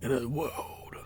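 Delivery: hushed, husky trailer intro; movie trailer voice. Pretend. Voicing a mock film trailer, movie trailer voice. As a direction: whisper it like a trailer opener. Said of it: "trailers for movies".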